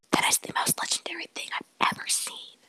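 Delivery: breathless, hushed disbelief; stunned whispered praise; awed whisper. Retold or imitated. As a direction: whisper it like you can't believe what you saw. Friend's awed reaction, retold.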